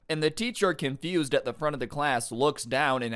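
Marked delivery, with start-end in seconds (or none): none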